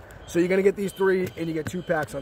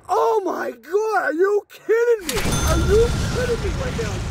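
high, loud squeal of disbelief; real excited disbelief; 12 semitones higher, 4 dB louder than usual. Real, not acted. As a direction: lose it over an incredible pull. Pulls several valuable cards.

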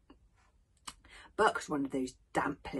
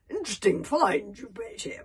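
proud father; 5 semitones higher, 5 dB louder than usual. Acted.

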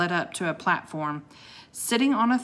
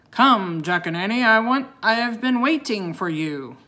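big, loud summoning voice; bellowing; 4 semitones higher, 6 dB louder than usual. A narrator's reading. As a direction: bellow it like a commanding host. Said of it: "bellowed the voice".

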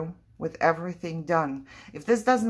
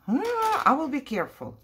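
higher and louder, alarmed promise; startled exclaim; 8 semitones higher, 4 dB louder than usual. Real, not acted. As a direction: blurt it out after a near slip.

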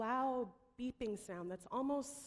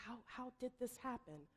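softer, searching under the breath; frantic inner echo; 3 semitones higher, 6 dB quieter than usual. Retold or imitated. The speaker asks himself in his head, softly frantic. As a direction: echo the question to yourself, searching for answers. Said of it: "Frantically trying to search my brain".

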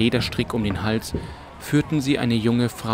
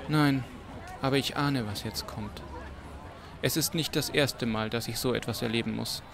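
soft and resigned, much quieter; sadly foresee the worst; 9 dB quieter than usual. Acted. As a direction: Ewan resigned, murmuring to Ratran. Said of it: "sah das Mädchen mit traurigem Gesicht an".

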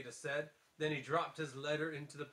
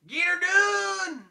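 Larry the Cable Guy; 14 semitones higher, 11 dB louder, slower than usual. Acted. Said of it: "Just think of Larry the Cable Guy".

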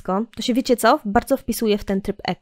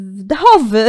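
laughing; 7 semitones higher, 8 dB louder than usual. Real, not acted. Laughs at the old word.

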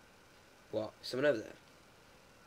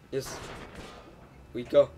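ordinary talk, nothing marked

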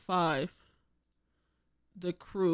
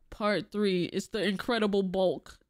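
ordinary talk, nothing marked

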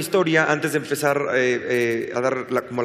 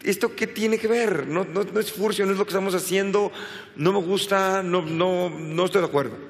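higher, exasperated objection; indignant protest; 5 semitones higher than usual. Retold or imitated. Retelling how he objected to the producers' sexist script, indignant. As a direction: refuse it with disbelief. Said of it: "Y yo decía".

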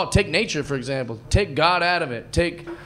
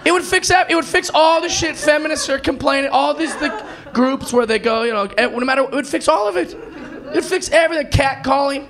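normal talking voice

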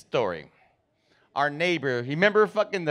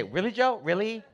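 high, rising disbelieving questions; mom's exasperation; 5 semitones higher, 7 dB quieter than usual. Retold or imitated. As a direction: repeat it higher, incredulous, like a fed-up wife. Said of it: "then she would always say".

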